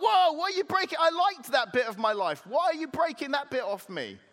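very high, loud mock alarm; hammed-up wounded objection; outraged protest. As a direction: start with a yelp, then plead indignantly. Clay protests bits being broken off.

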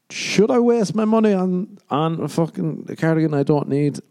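high, emphatic self-questioning; mock deliberation. Shopper tempted by a sale, mock-agonised deliberating. Acted inner debate over a sale.

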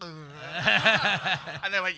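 laughing through the words; words breaking into laughter. Real, not acted. Guest laughing while retelling the bar story.

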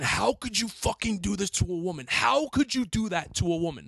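high-pitched indignant yelling, re-enacted; retold outraged scream. Chasing the man who threw change, retold outraged scream. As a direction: relive screaming at someone in outrage. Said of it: "I started screaming".

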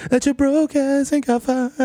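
high, slow, sly street-dealer voice; squeaky, drawn-out hustler pitch; dealer character voice. Acted. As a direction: lean in and tempt the buyer slowly. Weed dealer character bit.

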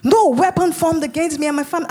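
re-enacted prayer declaration, firm and insistent; fervent prayer. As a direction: declare it like a repeated prayer, punching the opening. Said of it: "all I was saying in the spirit".

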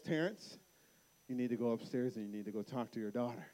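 low, soft, quick instruction; calm firm wife. Retold or imitated. Wife tells dad to go upstairs, imitated quiet firmness. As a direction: imitate a wife insisting without raising her voice.

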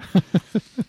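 high, loud laugh; burst of laughter. Real, not acted. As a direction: laugh out suddenly. Laughs at own lack of water.

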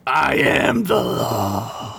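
slow, drawn-out lawgiver voice; a slow put-on tyrant; mock decree. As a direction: slowly boom out the line as a mock judge. Playing the stern governor.

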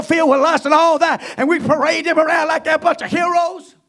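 shouting, high fiery preaching; doomsday street preacher. Real, not acted. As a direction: thunder out a warning of judgment.

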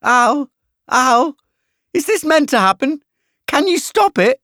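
high, loud, distressed moaning; Carly's moan. A narrator's reading. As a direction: cry out in pained protest. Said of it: "I hear Carly moan".

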